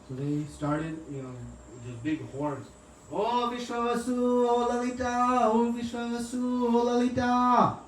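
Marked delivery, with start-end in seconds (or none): 3.1-7.8 high long call across distance. Retold or imitated. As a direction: stretch each oh like a call through a horn. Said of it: "Like this, they're calling".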